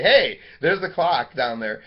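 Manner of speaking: loud, slow call-out to oneself; marked inflection. Shown as a demonstration. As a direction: call it out loudly as a spoken note. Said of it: "because of my inflection".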